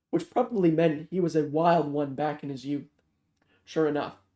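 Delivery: plain reading voice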